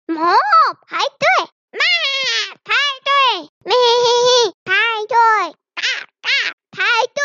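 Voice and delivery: high animal calls before each order; moo, bleat and quack, then demand queuing; various animal voices. A narrator's reading. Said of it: "很多动物都说".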